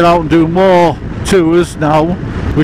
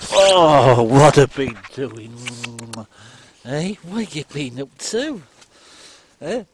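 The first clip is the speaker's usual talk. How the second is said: talking to a dog; 4 semitones lower, 7 dB quieter than usual. Real, not acted. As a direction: coo gently at the pet. Still fussing over dog.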